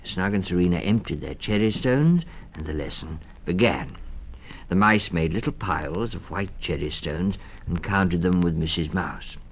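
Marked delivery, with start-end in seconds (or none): none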